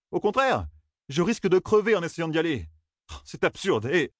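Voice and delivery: high-pitched, agitated arguing; exasperated protest. A narrator's reading. Young man asking why he must die, exasperated. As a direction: argue back heatedly, pitched high.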